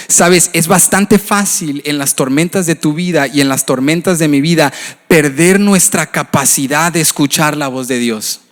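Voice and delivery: ordinary delivery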